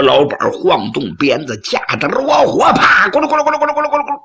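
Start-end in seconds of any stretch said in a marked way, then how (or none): 2.7-4.2 一声鞭响，接着飞快连串的轱辘声; 嘴里学大车飞奔的声响; 模仿车轮滚动. Acted. 车老板赶车上路. (模仿鞭响和车轮飞转声)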